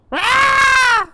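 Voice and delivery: very high loud scream; scream of fright. Real, not acted. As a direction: shriek at the scare. Jump scare scream.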